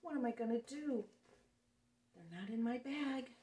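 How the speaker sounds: usual speaking voice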